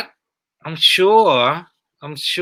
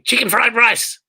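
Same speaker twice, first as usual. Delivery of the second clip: imitated heckler scream; 8 semitones higher than usual. Drunk heckler shouting at the comic, mock yell. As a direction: call it out from the dark crowd. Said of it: "keep screaming".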